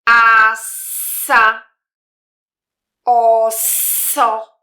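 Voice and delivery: "Asa, oso" very drawn out, with a long held s between the vowels; loud, slow model of "Asa, oso" with sustained middle s; syllable demo. Shown as a demonstration. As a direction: stretch both "Asa" and "oso" slowly, hissing through the s. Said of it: "Ma wyglądać to tak".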